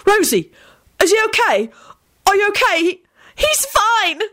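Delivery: high, urgent voice; frantic exclamations. A narrator's reading. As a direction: burst out with frantic concern. Frantic William finds Rosie, reassured.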